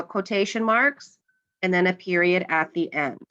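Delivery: usual speaking voice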